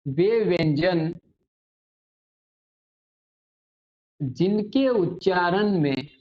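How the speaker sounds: teacher dictating a definition slowly with long pauses; slow dictation. Acted. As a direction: read it out word by word for pupils to write. (धीरे-धीरे परिभाषा लिखवाना)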